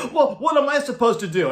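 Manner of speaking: mock the star's outraged helplessness; hammed-up huffy protest; imitating an indignant complaint. Angry Dio complains.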